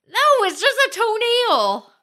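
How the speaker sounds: loud protest. Real, not acted. Objecting loudly.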